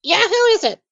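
high-pitched, wary-sounding occupant; voice behind the door. Acted. Resident answering a knock on the door, high character voice. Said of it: "you hear someone say".

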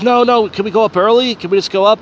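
a hint of desperate whining; Roger begging. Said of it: "and then he's like".

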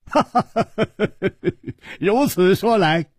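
high-pitched acted laughter running into speech; hearty laughing. A narrator's reading. Chu Liuxiang clapping at the boy's answer, laughing. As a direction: burst out laughing, then carry on amused. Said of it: "楚留香抚掌笑道".

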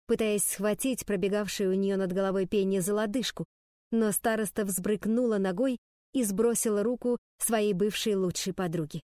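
usual speaking voice

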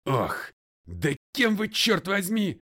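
loud protest climbing high; outraged. A narrator's reading. McGray protesting to the father, outraged. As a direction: burst out indignantly, pitch shooting up.

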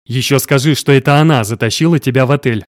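ordinary delivery, nothing marked

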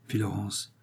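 plain reading voice

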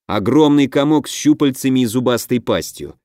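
usual speaking voice

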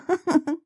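short, high, quiet giggle. A narrator's reading. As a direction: let out a little titter. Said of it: "Соломея хихикнула".

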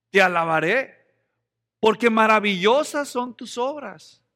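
loud, slow, triumphant praise; proclaiming praise. Retold or imitated. Psalmist praising God for his body, loud and slow.

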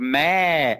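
"mé" drawn out slowly on its tone; Thai tone. Shown as a demonstration. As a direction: stretch "mé" as a long toned syllable. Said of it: "Então, no tailandês é assim". ("mé" alongado, com o tom tailandês)

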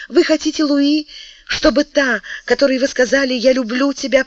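high, loud, urgent plea; urgent pleading. Acted. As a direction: press him for the one word. Решительная минута, мольба. (настойчивая мольба, высоко и громко)

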